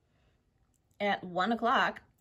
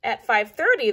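ordinary delivery

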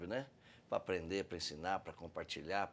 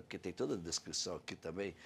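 usual speaking voice

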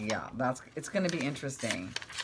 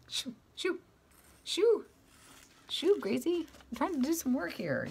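much higher, squeaky calls to shoo the cat; 14 semitones higher than usual. Real, not acted. Craft vlogger shoos her cat off the desk, high-pitched calls. Shooing the cat Gracie away.

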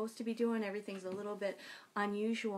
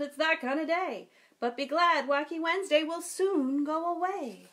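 storytime reading; 4 semitones higher, 6 dB louder than usual. A narrator's reading. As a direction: read it like a picture book to kids. Reads picture book aloud.

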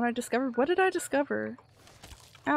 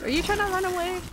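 high taunt; 9 semitones higher, 5 dB louder than usual. Real, not acted. Taunting a fleeing enemy.

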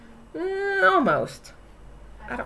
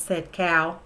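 plain reading voice

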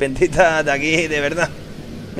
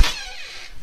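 very high slow squeak; squeaky voice; 13 dB quieter than usual. Acted. Snake staring.

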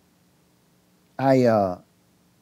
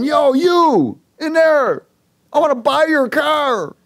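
slow, high and louder call from a distance; 13 semitones higher, 5 dB louder, slower than usual. Retold or imitated. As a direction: stretch it out like hollering across a park. Stranger calling out from truck.

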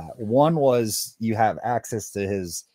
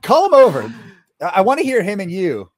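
high, loud, eager call; excited urging; 9 semitones higher, 10 dB louder than usual. Real, not acted. Co-host begs for the impression face to face, excited shout. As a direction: cry out delightedly for an encore.

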